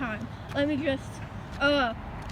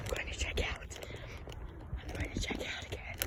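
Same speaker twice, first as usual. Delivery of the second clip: breathy whisper, almost no voice; 17 dB quieter than usual. Pretend. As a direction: whisper it as if sneaking past. Customer sneaking to checkout.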